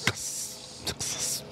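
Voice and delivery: hushed, breathy "Success"; whispered success. Real, not acted. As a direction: murmur a private victory. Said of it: "celebrating the last Coke".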